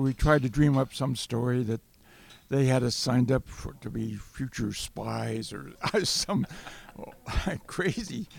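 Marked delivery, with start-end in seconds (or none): 3.8-8.3 laughing through the words, slowing down; chuckling. Real, not acted. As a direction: let a real chuckle break in. Laughing at absurd cover story.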